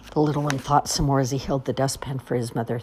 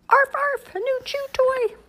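very high yappy puppy; dog voice. Acted. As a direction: yap it like a small dog.

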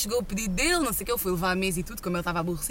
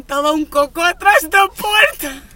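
mock disbelief; 5 semitones higher, 8 dB louder, slower than usual. Retelling shocking toilet discovery.

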